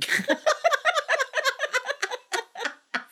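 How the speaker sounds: high burst of laughter; loud laugh. Real, not acted. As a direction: crack up out loud. Laughing at the line.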